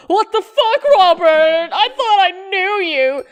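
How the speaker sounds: mock outrage. Real, not acted. To Robert on screen, hammed-up betrayed outrage. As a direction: cry out at him in mock betrayal.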